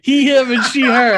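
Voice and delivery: loud, high, through laughter; laughing teasing. Real, not acted. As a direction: crack up while correcting your friend. Teasing friend's jumbled pronouns while laughing.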